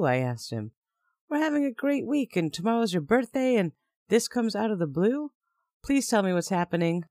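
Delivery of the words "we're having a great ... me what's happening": high pleading, rising panic; panicking. Retold or imitated. Woman panicking as her boyfriend dumps her. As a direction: beg him to explain, frightened. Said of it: "I asked, panicking".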